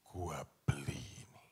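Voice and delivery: low, unvoiced throwaway line; whispered casual boast; offhand whisper. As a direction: say it as if it costs nothing. Rich man buying the hotel.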